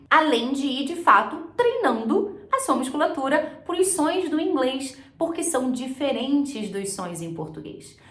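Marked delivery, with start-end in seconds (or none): none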